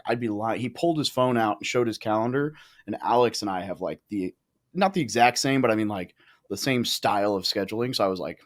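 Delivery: usual speaking voice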